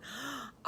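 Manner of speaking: sharp breathy gasp of joy; delighted gasp. Acted. Wife gasps with delight.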